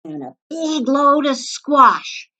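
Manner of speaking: high, slow bossy command; barked order. A narrator's reading. Varmint barking at the bunny, high and slow. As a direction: order it like a grumpy chef. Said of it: "barking orders".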